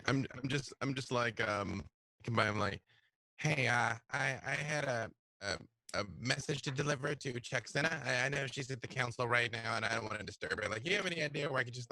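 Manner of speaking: normal talking voice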